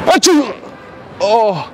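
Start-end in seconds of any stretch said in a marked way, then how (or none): 0.1-0.5 fake sneeze. Prank fake sneeze at stranger.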